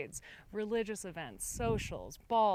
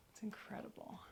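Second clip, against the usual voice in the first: breathy awed whisper; nervous night vlog whisper; 4 semitones higher, 12 dB quieter than usual. Real, not acted. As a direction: whisper it in quiet wonder. Hushed awe inside old house.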